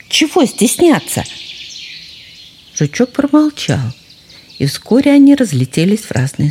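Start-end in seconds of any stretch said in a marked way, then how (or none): 0.1-1.2 indignant fox cub. Acted.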